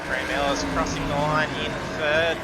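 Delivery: loud, driven race call; race commentary. Real, not acted. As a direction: call the finish like a live broadcast.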